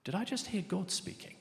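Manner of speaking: hushed, tentative wondering; wondering sense. Acted. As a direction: ask it softly, as a dawning thought. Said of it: "have got that sense".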